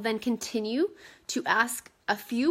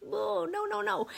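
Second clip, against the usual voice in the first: mock dismay; 10 semitones higher, 5 dB quieter than usual. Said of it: "I was like".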